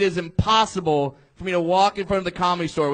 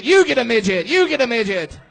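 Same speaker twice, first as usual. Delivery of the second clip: louder, higher game-show giveaway; mock giveaway shout; 4 semitones higher, 4 dB louder than usual. Comic offering the crowd a prize, excited mock giveaway. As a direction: hand it out like a talk-show host.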